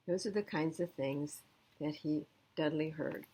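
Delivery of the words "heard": ordinary delivery, nothing marked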